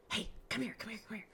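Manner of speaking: hushed, hurried summons; retold secretive whisper; urgent whispered beckoning. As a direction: whisper to call someone over discreetly. Beckons colleague secretly from office door.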